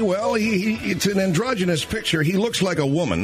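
punchy radio host opening the show. Acted. Host opening his nightly show for listeners, announcer delivery.

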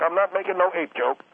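normal talking voice

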